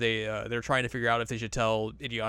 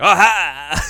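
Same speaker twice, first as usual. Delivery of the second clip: a sudden loud "Aha!" cry, much louder; mock triumphant cry; 6 semitones higher, 13 dB louder than usual. Freed girl bursting out with a loud excited cry. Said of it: "She's like".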